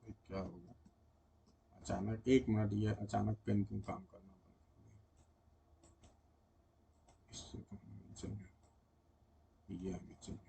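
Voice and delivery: very quiet, low, under the breath; real low, barely audible self-talk; muttering to self. Real, not acted. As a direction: mutter privately while puzzling over a dead pen. Troubleshooting a dead pen, talking to himself.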